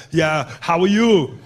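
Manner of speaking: slow, drawn-out star brushing off a fan; lightly put-on accented hero voice, unhurried; Arnold Schwarzenegger. Star not even looking at a fan, indifferent. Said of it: "He was just like".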